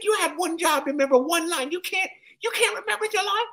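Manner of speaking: put-on high, scolding old man voice; lecturing Cosby impression. Comic as Cosby scolding him in front of cast, high lecture. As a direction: wag a finger in a squeaky lecture.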